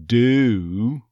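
"do" drawn with a glide into the vowel. Shown as a demonstration. Coach shows the on-glide, stretched "do". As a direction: slide into the oo of "do". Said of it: "that same on glide".

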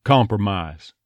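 "compromise" with slightly rounded first vowel; Texas "compromise". Shown as a demonstration. Coach models the Texas word, rounded "compromise". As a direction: round the "com", flatten the "-ise" to ah. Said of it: "first vowel gets a little bit of rounding".

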